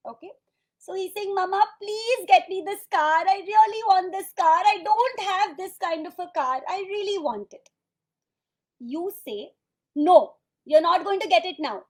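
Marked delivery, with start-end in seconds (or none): 1.3-7.7 put-on higher, louder child's begging; pleading six-year-old. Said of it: "your six-year-old child is crying". 9.9-11.8 firm parental refusal. Acted. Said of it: "Now you're getting angry".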